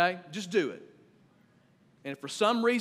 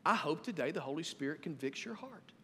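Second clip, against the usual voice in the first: ordinary delivery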